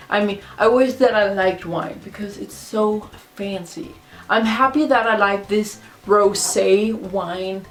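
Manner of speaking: usual speaking voice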